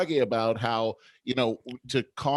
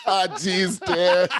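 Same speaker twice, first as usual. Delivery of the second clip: high, loud protest through laughter; laughing; 10 semitones higher, 3 dB louder, slower than usual. Real, not acted. Reacting to co-host's Archie Bunker tease, laughing hard. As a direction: crack up while groaning at the joke.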